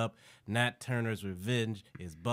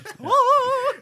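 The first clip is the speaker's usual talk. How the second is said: very high, loud burst of laughter; shrieking laugh; 15 dB louder than usual. Real, not acted. Co-host cracking up at the riff, high shrieking laugh.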